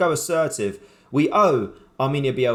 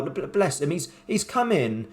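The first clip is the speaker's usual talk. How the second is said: ordinary talk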